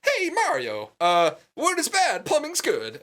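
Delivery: high mock Italian accent. Luigi advising Mario, in a mock Italian accent. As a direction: ham up a cartoon Italian accent. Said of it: "Best Italian accent ever".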